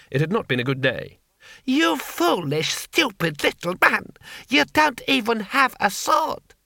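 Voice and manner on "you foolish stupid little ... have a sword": high, slow contemptuous tirade; scornful Lord Hong. A narrator's reading. Lord Hong insulting Two Flower, slow contemptuous anger. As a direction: spit out the insults slowly.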